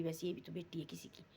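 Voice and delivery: softly whispered inner thought; a hushed aside of pity. Retold or imitated. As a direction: whisper it to yourself. Daughter's private worried thought.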